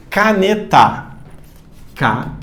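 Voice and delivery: "Caneta" broken into ca, ne, ta; separado por sílabas. Shown as a demonstration. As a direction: say "Caneta" syllable by syllable with pauses. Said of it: "Separa por sílaba". ("Caneta" dividida em sílabas, com pausas)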